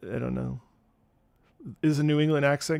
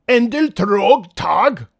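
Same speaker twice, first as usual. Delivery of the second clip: loud, drawn-out mock proclamation; mock foreign bellow; 2 semitones higher, 8 dB louder, much slower than usual. Streamer showing off languages to chat, mock bellow. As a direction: bellow it slowly in a put-on voice. Sudden put-on foreign greeting.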